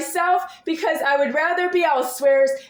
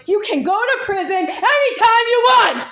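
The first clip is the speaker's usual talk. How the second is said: higher, louder furious yell; angry shout; 8 semitones higher, 6 dB louder than usual. Real, not acted. Yelling at the accuser to go to prison. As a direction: shout him down. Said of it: "I'm sure I'm shouted".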